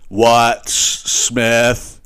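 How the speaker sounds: "what Smith" drawn out in a put-on voice; mocking the odd "what". Mimicking the contestant's odd question. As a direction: stretch "what" oddly, like the contestant. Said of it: "not who is John Smith".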